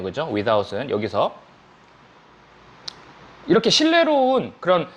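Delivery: ordinary talk, nothing marked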